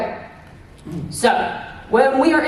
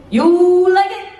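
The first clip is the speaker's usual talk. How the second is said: higher, louder hype call to the crowd; excited call-out; 6 semitones higher, 6 dB louder, slower than usual. Shown as a demonstration. Idol hyping the crowd, excited call-out. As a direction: shout it out to the fans.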